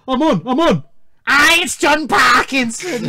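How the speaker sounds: high, loud mocking caller impression; exaggerated prank caller, drawn out at the end; John Parkinson. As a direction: shout it as a hammed-up parody of bad acting. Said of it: "That's some poor acting right there".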